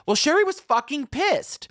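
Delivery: normal talking voice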